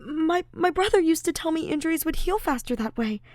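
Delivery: high, nervous, flustered friend; flustered Ash. Acted. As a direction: explain away the embarrassment.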